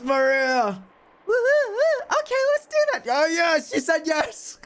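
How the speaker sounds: a cackling witch egging Maria on; taunting witch. Acted. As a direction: goad the child gleefully.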